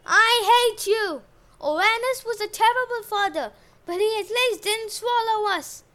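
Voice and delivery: loud, high-pitched acted fury; angry outburst. A narrator's reading. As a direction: lash out at your husband, loud and high. Wife's acted tantrum at husband.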